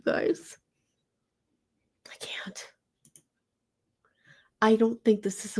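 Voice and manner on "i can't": breathless whisper of disbelief; a real, hushed aside; stunned whisper. Real, not acted. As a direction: whisper it like you can't go on. Overwhelmed reaction to video.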